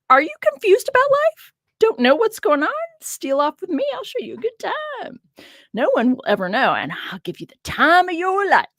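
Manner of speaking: high, sing-song Southern come-on voice; Southern accent. Acted. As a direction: call out to passersby like a Southern temptress. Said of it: "I just made that kind of Southern".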